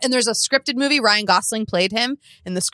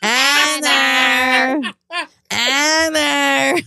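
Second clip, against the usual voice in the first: grandma's voice; 4 semitones higher, much slower than usual. Retold or imitated. Granddaughter imitating grandma calling her name, stretched-out call. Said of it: "My grandma called me Anna".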